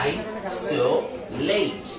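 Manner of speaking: I, so, late as three separate chunks with pauses; split into parts. Shown as a demonstration. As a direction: break I so late apart for pupils to copy. Word broken up for pupils.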